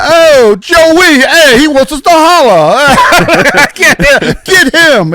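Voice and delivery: high, loud mock northern city accent. Father retelling Army days, mock northern city accent. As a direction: ham up a northern Army recruit. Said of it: "two guys from a certain northern place".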